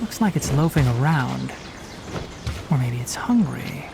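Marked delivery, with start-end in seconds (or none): 2.7-3.9 whispered under the breath, musing; whispered guess. Acted. Whispering a guess to the partner about the stalled beast. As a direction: breathe it out as a private thought.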